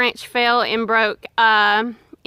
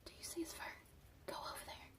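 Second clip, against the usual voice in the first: whispering; 31 dB quieter, much faster than usual. Real, not acted. Whispering near a wild animal.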